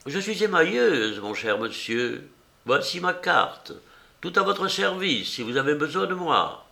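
high, sweetly mock-polite old woman; old enameller woman. Old woman introducing herself to the painter, high and coy. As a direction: play the coy old artist handing over her card.

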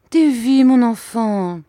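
put-on a mother reaching for her child, pleading; tender pleading mother. Mother coaxing her crying boy, high tender plea.

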